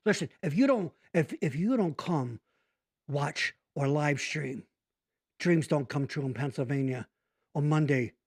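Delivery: low, menacing Italian American gangster voice; Italian mobster accent. Pretend. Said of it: "that got fucking dark".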